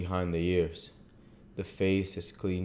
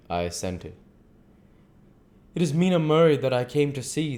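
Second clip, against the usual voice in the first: higher, louder voice for the earnest foreign doctor; earnest old doctor; 7 semitones higher, 4 dB louder than usual. Acted. The doctor to Mina, explaining his visit, grave and courteous.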